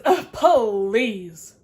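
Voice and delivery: loud, dismissive scoff; scoffing rabbit. Acted. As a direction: roll your eyes at it. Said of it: "The rabbit says".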